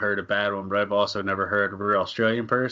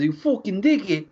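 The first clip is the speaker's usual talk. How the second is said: mock Irish accent; 8 semitones higher than usual. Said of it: "Irish bro slash Boston accents".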